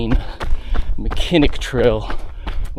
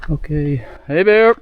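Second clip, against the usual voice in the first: calling out. Real, not acted. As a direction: call out to warn a bear. Calling out to a bear.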